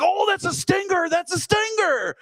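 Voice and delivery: high yelping dad in pain; imitating dad. Son imitating father shot in the leg, high yelp. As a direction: squeal it like a hurt father hopping around. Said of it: "and he's like".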